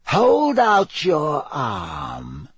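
very slow, lazy villain's command. A narrator's reading. Dark lord orders his wounded servant, lazily drawling. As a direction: stretch each word out with lazy menace. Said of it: "said Voldemort lazily".

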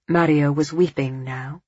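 plain reading voice

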